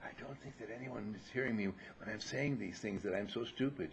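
soft, muttered aside; mumbling quietly. Retold or imitated. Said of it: "They talk like this".

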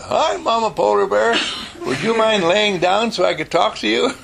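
put-on high, laughing little-girl greeting; sweet girl voice. Mickey calls up to the mama bear, high and giggly. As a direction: chirp up at the big bear, laughing.

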